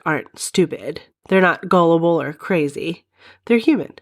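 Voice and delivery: ordinary talk, nothing marked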